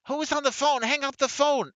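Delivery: imitated woman shouting from far off; woman in background. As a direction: call out from the next room as a suspicious woman. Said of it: "somebody on the phone in the background again says".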